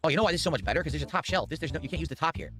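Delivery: usual speaking voice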